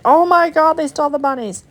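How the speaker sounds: high, loud mock outrage; mock dismay. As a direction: yelp it like the bunnies were stolen. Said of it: "and I was like".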